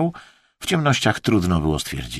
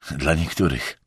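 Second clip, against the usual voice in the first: low, dispassionate aside; impassive; 4 semitones lower, 4 dB quieter than usual. A narrator's reading. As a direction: toss it off without feeling. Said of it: "odparł beznamiętnie Emerson". (beznamiętnie, nisko)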